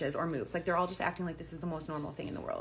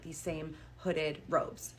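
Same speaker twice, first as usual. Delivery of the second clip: usual speaking voice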